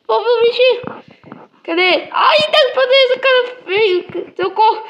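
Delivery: high, loud squeals, giggling through the fright; squealing with scared laughter. Real, not acted. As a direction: shriek and laugh at the monster. Creature chasing her in game. (gritos agudos de susto, rindo)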